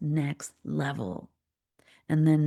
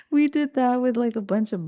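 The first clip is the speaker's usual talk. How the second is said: laughing; 7 semitones higher, 5 dB louder than usual. Real, not acted. Laughing at her staged photos.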